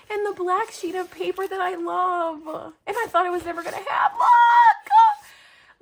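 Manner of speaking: high voice breaking with happy tears; overcome, near crying. Real, not acted. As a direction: choke up, then burst into a loud squeal. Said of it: "Okay, don't cry, [name]".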